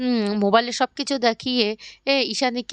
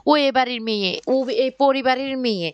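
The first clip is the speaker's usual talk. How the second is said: plain reading voice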